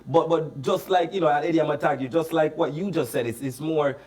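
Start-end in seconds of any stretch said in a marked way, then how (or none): none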